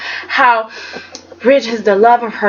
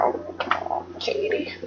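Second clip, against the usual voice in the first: exasperated plea; 3 semitones higher, 7 dB quieter than usual. Real, not acted. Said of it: "I was just like".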